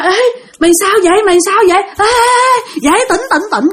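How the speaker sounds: high-pitched, urgent retold panic; panicked calling. Girl trying to wake her fainted friend, panicked. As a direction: try to rouse her, voice pitched right up.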